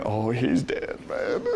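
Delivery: low, soft aside to oneself; hushed dread. Retold or imitated. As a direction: drop to a quiet mock-horrified murmur. Same dreading thought, repeated.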